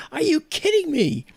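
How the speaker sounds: loud, high-pitched disbelief; incredulous. Retold or imitated. Learning the friend is an astrophysicist, high incredulous cry. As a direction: exclaim it in re-enacted amazement. Retold astonishment at the revelation.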